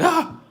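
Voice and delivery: high, sharp startled yelp; gasp-scream as if spooked. Retold or imitated. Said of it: "she'll be like".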